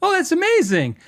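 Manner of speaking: high-pitched mock-delighted gush; forced, high customer service cheer; customer service smile. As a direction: put on a big customer-pleasing smile. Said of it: "supposed to have the customer service smile".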